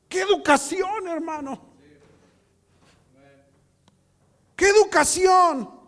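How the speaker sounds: loud, high exclamations to the congregation; impassioned preaching. Real, not acted. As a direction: cry it out like an indignant preacher.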